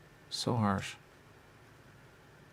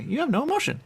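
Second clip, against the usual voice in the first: mock accuser; 4 dB louder than usual. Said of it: "They say".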